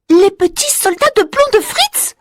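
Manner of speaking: put-on high girl's voice, exclaiming; a little girl crying out in delight; Marie exclaiming. A narrator's reading. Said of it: "s'exclame Marie". (petite fille qui s'exclame)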